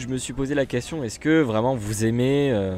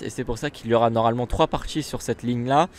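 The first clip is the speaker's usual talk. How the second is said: ordinary delivery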